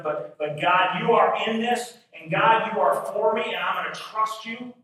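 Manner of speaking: loud, high declaration to God; expectant trust. Acted. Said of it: "An attitude of expectancy says".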